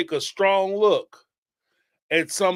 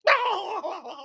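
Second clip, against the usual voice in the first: high laugh; 13 semitones higher, 3 dB quieter than usual. Real, not acted. Laughing at own mockery.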